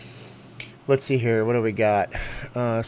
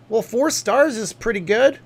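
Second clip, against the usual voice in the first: much higher, louder, slow mock voice; mock a gullible reader; naive optimist; 10 semitones higher, 7 dB louder than usual. Mocking someone trusting review stars, high mock voice. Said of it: "You think".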